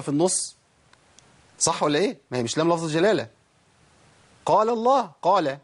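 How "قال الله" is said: قال الله with light first lam, full heavy الله. Shown as a demonstration. Teacher shows the correct الله, heavy lam after light قال. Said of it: "لام لفظ الجلالة المفخمة".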